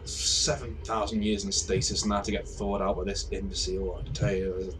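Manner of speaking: slow, soft grumble under the breath; grumbling aside. Acted. Device thawed out by an imbecile, grumbling aside. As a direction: complain wearily to yourself.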